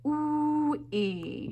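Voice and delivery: slow, drawn-out ooh-ee; saying ooh-ee. Shown as a demonstration. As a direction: stretch out the ooh-ee vowels. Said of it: "Can you go".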